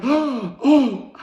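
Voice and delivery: high, loud gasping disbelief; imitated shock. Acting out the boy hearing he won, shocked. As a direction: gasp and cry out like a stunned kid. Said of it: "He was so shocked".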